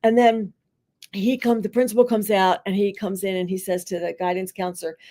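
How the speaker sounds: ordinary talk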